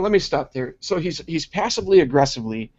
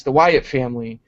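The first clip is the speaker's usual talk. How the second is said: ordinary talk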